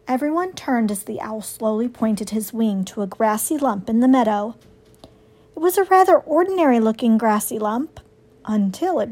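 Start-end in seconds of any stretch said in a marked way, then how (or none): none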